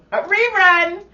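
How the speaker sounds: high, loud, gleeful cry; excited exclamation. Real, not acted. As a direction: blurt it out delighted. Joking exclamation about rerun.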